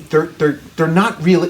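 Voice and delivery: ordinary talk, nothing marked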